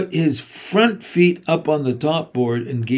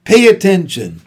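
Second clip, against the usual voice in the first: louder, higher command; urgent yell; 4 semitones higher, 7 dB louder than usual. A narrator's reading. Wilbur rouses the sleeping rat, yelling urgently. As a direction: yell to get someone's attention. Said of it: "screamed Wilbur".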